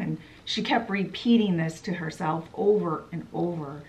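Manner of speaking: ordinary delivery, nothing marked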